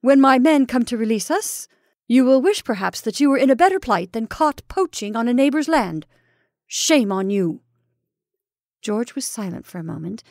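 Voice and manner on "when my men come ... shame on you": loud, raised, scornful warning; angry threat. Acted. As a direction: lash him with the threat. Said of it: "retorted Ulrich".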